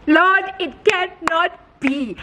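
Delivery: slow, hammed-up cry of disbelief to heaven; dramatic disbelief. Vlogger seeing the house listed again, dramatic slow disbelief.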